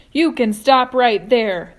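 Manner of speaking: loud, sharp and slow warning; said sharply. A narrator's reading. Earthworm cuts James off sharply, loud and slow. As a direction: cut him off sharply. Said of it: "the earthworm said sharply".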